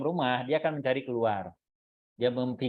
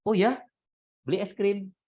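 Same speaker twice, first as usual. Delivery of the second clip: eager agreement; 7 semitones higher than usual. Shown as a demonstration. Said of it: "Anda kalau pakai logika (contrast: agree first)".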